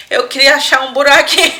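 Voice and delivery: fast, loud words through laughter; laughing. Real, not acted. Host laughing at the embarrassment, fast and loud. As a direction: push the line out while cracking up. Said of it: "eu tô rindo aqui".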